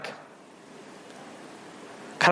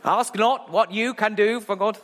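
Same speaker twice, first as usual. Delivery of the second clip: botched JFK line; 6 semitones higher, 3 dB louder, slower than usual. Retold or imitated. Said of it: "No, no, I've done it wrong".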